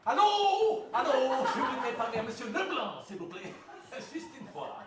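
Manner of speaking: hammed-up Belgian producer on the phone; mock Belgian accent. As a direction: fake Belgian accent, lightly put on. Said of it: "Je prends l'accent belge". (faux accent belge de producteur au téléphone)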